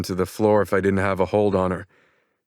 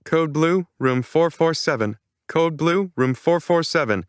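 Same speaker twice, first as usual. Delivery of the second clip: higher, even PA announcement; automated announcement; 9 semitones higher than usual. A narrator's reading. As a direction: read it like a hospital intercom. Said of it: "the automated voice announces through the speakers".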